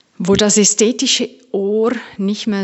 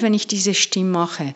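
normal talking voice

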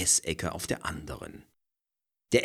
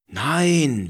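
vehement; 10 semitones higher, 8 dB louder than usual. A narrator's reading. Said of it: "widerspricht Frederike vehement".

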